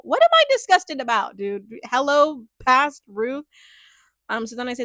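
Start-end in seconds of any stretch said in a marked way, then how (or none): 0.0-3.5 loud, high-pitched disbelief at yourself; exasperated, raised-voice self-questioning; mock exasperation. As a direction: call out your past self in bafflement. Baffled at her own old notes.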